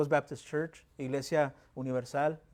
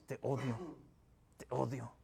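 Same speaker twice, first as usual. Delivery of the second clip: a quiet, seething te odio, much softer; hateful mutter; 2 semitones lower, 8 dB quieter than usual. Retold or imitated. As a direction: grind out the words with hatred under the breath. Voicing the damned who grind teeth.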